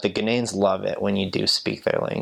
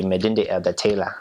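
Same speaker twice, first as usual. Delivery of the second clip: Ghanaian accent. Shown as a demonstration. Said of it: "say it sort of in their accent".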